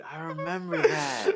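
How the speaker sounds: cracking up while remembering; laughing. Real, not acted. Friend remembers the professor's stolen pen, laughing.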